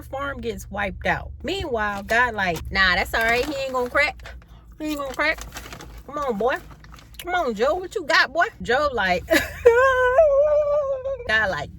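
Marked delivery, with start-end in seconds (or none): 2.7-8.5 louder, higher hype-man cheering; hyped cheering. Acted. God cheering Job on. 9.3-11.2 high wailing half-laugh half-sob; a loud mock wail that tips into laughter; wailing laugh-cry. Job's reaction to losing farm.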